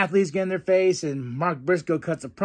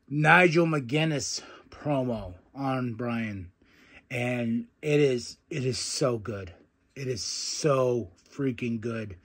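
hushed awe; 4 semitones lower, 3 dB quieter, slower than usual. Real, not acted. Awed by the promo.

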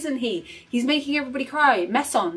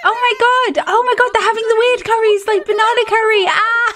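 high, loud, squealing delight; high excited fangirl shrieking; thrilled shriek; 11 semitones higher, 6 dB louder than usual. Real, not acted. Excited reaction to the curry scene.

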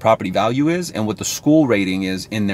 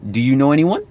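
"anyone?" swept upward like an uncertain question; tentative asking rise on "anyone"; rising question tone; 4 semitones higher than usual. Shown as a demonstration. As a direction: end "Do you know anyone?" on a hopeful upturn. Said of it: "you're gonna notice your tone goes up".

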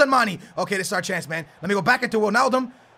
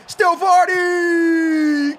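stretched shout; 8 semitones higher, 7 dB louder, much slower than usual. Real, not acted. Pair: stretched yell.

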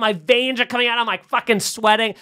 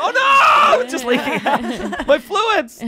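1 mock panic; 12 semitones higher, 3 dB louder, slower than usual. Mock panic at leaking bulb.

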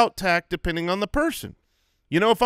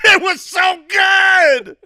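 very high, loud, stretched-out yell; top-of-lungs shout; 13 semitones higher, 9 dB louder, much slower than usual. Shown as a demonstration. Answering a question about last night's movie, shouted at full volume. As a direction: bellow the praise as loud as possible. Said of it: "shout your answer at the top of your lungs".

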